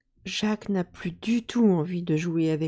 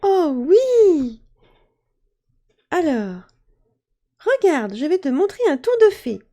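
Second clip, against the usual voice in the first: high, bright and quick fairy voice; a little fairy showing off; excited fairy; 8 semitones higher, 7 dB louder than usual. Acted. Fairy eager to show Jacques a trick, excited and high.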